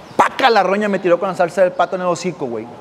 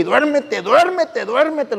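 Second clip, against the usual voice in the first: higher, insistent duérmete; mother forcing sleep; 6 semitones higher, much slower than usual. Retold or imitated. Mother ordering the kids to sleep, high and insistent. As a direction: push the kids to sleep, pitched up. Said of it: "güey, y".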